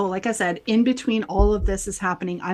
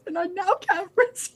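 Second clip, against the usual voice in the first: laughing retold complaint; 9 semitones higher than usual. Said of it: "I'm like".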